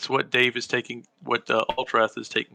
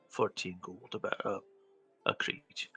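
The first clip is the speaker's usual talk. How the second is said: low, quiet mumble; muttering; 6 semitones lower, 13 dB quieter than usual. Acted. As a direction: mumble it into your beard. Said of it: "Lou, you were muttering".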